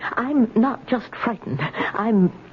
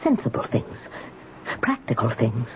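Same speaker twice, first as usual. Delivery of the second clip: softer, breathless musing to herself; barely voiced, lower afterthought; near-whisper; 8 semitones lower, 5 dB quieter than usual. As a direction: let it sink to a whisper. Musing to herself, voice drops away.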